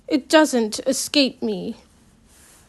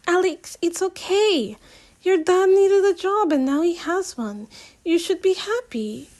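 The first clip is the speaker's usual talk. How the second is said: higher, lighter voice for Simi; Simi's voice; 8 semitones higher than usual. Acted. Simi soothing her angry friend, higher lighter voice. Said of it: "Simi says".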